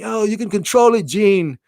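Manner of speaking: high-pitched, hammed-up British accent; attempted British accent. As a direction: put on a shaky attempt at British English. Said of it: "I'm trying to do a British accent here".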